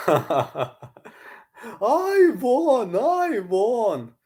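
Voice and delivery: very high strained put-on voice; Charlie Zelenoff impression. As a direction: ham up the deluded fighter. Imitating the fighter.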